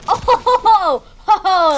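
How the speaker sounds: high, loud laughing ho ho; gleeful ho-ho laughter; delighted laughter. Real, not acted.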